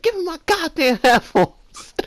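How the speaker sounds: high, loud, slow mock demand with a laugh; shrill drawn-out order, laughing through it; imitating an outraged big brother.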